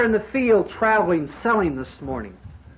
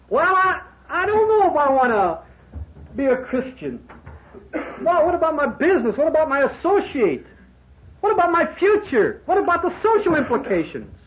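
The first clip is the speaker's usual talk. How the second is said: higher, fretful mock objector; worried doubter; 8 semitones higher than usual. Preacher mimicking a hesitant businessman's excuses, higher and fretful. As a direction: play the nervous businessman listing excuses.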